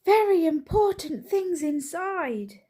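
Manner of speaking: high slow breathless insistence. A narrator's reading. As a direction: pant it out after the chase. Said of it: "gasped Mister Brown".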